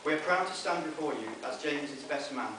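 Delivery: ordinary delivery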